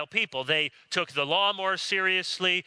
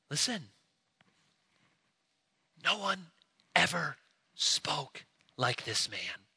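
awed hush; 5 dB quieter than usual. Retold or imitated. Officers answer in awe.

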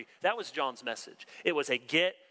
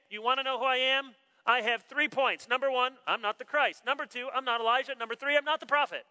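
higher, lively list of denials; impatient John; 8 semitones higher than usual. Retold or imitated. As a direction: tick off the points impatiently. John listing what he isn't.

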